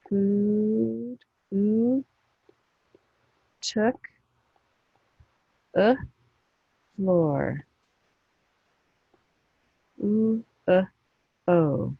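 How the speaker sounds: "Food", "took", "floor" drawn apart, each vowel echoed alone; phonics sounding-out. Shown as a demonstration. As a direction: isolate "oo", "uh", "o" after their words, with long pauses. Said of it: "Three sounds".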